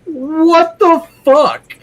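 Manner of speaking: high, drawn-out yell of disbelief; outraged yelling. Real, not acted. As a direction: stretch out a loud, exasperated shout. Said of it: "you ain't yelling hard enough".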